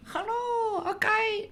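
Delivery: tiny high squeak in mock Scottish; soft, very high comic Scottish greeting; Scottish-sounding accent. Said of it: "No, they weren't Scottish".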